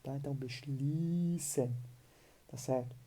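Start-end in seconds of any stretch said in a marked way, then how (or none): 0.3-1.7 "beschließen" with a long, drawn-out "ie"; long vowel. Shown as a demonstration. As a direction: stretch the "ie" in "beschließen" into one long ee. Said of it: "a pronúncia ela é bem longa".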